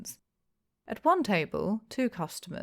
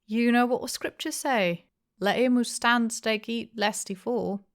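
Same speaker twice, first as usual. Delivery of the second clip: sententious quoting; 6 semitones higher, 5 dB louder than usual. A narrator's reading. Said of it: "said Mister Hempseed sententiously".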